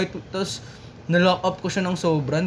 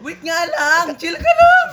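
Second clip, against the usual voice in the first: loud and high, fed up; exasperated shout; 11 semitones higher, 7 dB louder, slower than usual. Real, not acted. Fed up with the repeated question, shouting back. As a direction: yell at him to back off. Friend pressed repeatedly about grudge.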